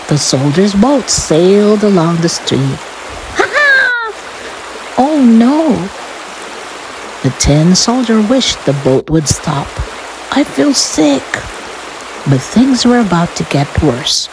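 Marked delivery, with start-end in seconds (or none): 3.3-5.7 very high cry of alarm; high-pitched acted panic; alarmed toy soldier. Soldier's boat sweeps down the street. 10.3-11.3 high-pitched seasick complaint; high, acted queasiness; queasy. Soldier queasy on the boat.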